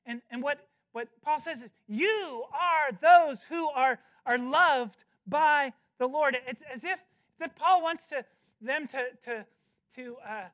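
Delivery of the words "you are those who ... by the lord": loud, slow "You are those who are loved"; slow emphatic declaration. Acted. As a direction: proclaim it over them. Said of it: "he wants to say emphatically".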